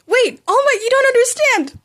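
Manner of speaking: high, loud yelling after him; panicked shout. Acted. Bakugo yelling after the fleeing hero, high panicked shout. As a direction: shout to stop him running.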